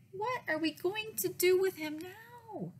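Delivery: high, wailing question; acted dismay, pitched well up; exasperated mom. A narrator's reading. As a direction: wail it like a mom at a loss.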